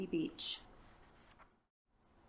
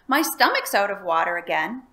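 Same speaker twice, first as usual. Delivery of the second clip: cheerful announcement; 8 dB louder than usual. A narrator's reading. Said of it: "announced the dragon cheerfully".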